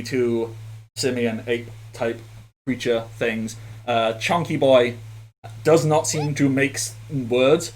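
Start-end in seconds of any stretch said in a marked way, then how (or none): none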